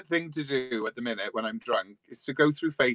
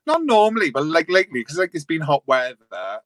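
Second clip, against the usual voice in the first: loud emphatic; 3 semitones higher, 7 dB louder than usual. Real, not acted. Emphatic lockdown complaint.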